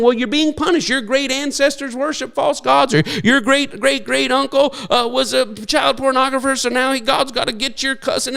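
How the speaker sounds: lightly acted, high-pitched accusing God; high mock scolding, only half in character; mock God's pronouncement. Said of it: "it's not God saying".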